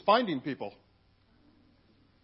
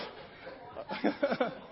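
breathless laugh; 7 semitones higher, 6 dB quieter than usual. Real, not acted. Laughing at new surname.

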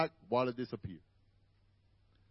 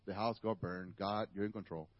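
calm acceptance; 8 semitones lower, 6 dB quieter than usual. Retold or imitated. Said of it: "I'm like".

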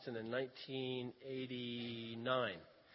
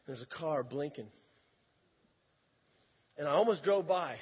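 ordinary talk

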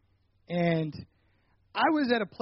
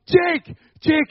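loud call with a high pitch; yelling a name; 8 semitones higher, 10 dB louder than usual. Retold or imitated. Friend searching outside, yelling for Jake. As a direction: shout it across the yard. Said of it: "starts yelling".

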